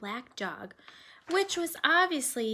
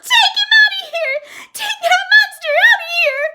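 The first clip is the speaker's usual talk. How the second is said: screaming much higher and far louder; terrified yell; 21 dB louder than usual. A narrator's reading. Girl on a chair screaming at her mother about the dog, terrified. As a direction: shriek it in panic from the chair.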